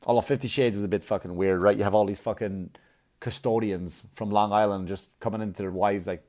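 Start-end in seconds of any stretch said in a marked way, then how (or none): none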